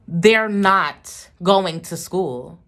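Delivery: slow, very loud, every word hammered; shouting. Retold or imitated. Mother yelling back at brother about school. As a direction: plant your feet and refuse.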